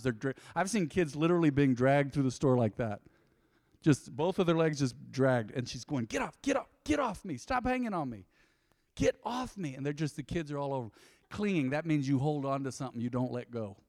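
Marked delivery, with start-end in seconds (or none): 6.1-9.7 exasperated mom. Acted. Mom to kids clinging to her legs, exasperated. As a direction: plead in a raised mom voice to be let go.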